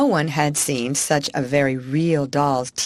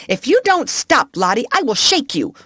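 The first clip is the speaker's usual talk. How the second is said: high, louder snapping threat; sudden scold; 7 semitones higher, 5 dB louder than usual. A narrator's reading. Miss Amelia to Lottie, a high loud sudden threat. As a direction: flip from coaxing to fury. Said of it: "in quite another tone".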